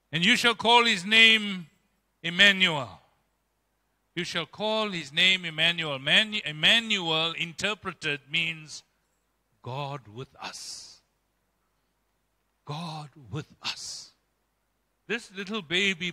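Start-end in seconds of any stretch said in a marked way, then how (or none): 0.1-3.0 louder, ringing proclamation of the prophecy; proclaimed scripture. Shown as a demonstration. Preacher proclaiming the prophecy to the congregation, louder. 9.6-14.1 very slow, much softer reverent words; hushed awe. Acted. Preacher dwelling on Emmanuel's meaning, slow and hushed.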